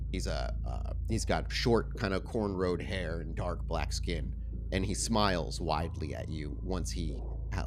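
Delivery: calm storyteller voice setting a vampire scene; game master narration. A narrator's reading.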